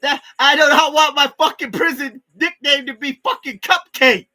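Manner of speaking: high, loud, whining complaint about the nickname; whiny protest. Retold or imitated. Inmate wailing at cellmates about his nickname, loud and whiny. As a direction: bawl it like a kid begging. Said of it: "I was like".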